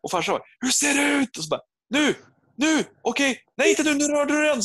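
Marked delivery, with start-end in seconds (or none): 0.6-1.4 high-pitched yell down from the roof; mock dad bellowing from afar; re-enacted relay shouting. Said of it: "brorsan står på bron och skriker". 1.9-4.6 high, strained yelling; mock panicked bellow across distance; re-enacted frantic shouting. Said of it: "så han skriker till farsan".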